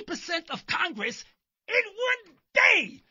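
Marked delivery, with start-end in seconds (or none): 1.7-3.0 high, loud, slow gleeful cry; mock glee. To his viewers about Congress, a slow gleeful cry. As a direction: stretch it out with mock delight.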